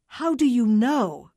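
insistent, each word pressed hard; forceful demand. A narrator's reading. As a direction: force the answer out of the caller. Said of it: "Carla tried to force the answers out".

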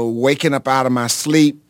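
usual speaking voice

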